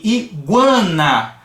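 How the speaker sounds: "iguana" drawn out, with a light u glide and a nasal an; "iguana" lento; slow demo word. Shown as a demonstration. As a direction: stretch "iguana" slowly. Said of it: "Acontece a mesma coisa".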